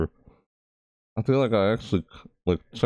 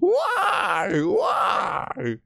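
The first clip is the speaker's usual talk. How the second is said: high, drawn-out wailing protest; distressed wail; 10 semitones higher, much slower than usual. Real, not acted. Player after double jump scare, wailing. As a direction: wail in dismay, voice way up.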